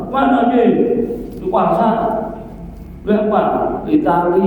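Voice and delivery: high, loud exchange shouted across a distance; loud calling out. Retold or imitated. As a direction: holler the back-and-forth between houses. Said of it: "Coba orang Sumatera".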